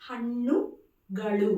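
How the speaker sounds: హన్నుగలు very slowly, with retroflex ణ and ళ; slow model of హన్నుగలు, tongue curled back; pronunciation demo. Shown as a demonstration. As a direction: stretch out హన్నుగలు part by part. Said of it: "pronunciation చూడండి ఇక్కడ".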